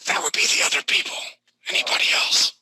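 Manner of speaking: unvoiced hushed aside, under the breath; whispered aside. Real, not acted. Game master whispers an aside to the players at the table. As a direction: drop to a whisper as if only the table should hear.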